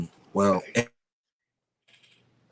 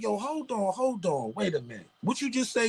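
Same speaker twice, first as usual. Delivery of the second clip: higher, softer, stunned; mock disbelief; 9 semitones higher, 7 dB quieter than usual. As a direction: stop him, you can't believe it. Stopping the boss in disbelief.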